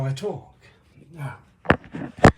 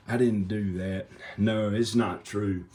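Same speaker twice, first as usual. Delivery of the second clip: ordinary talk, nothing marked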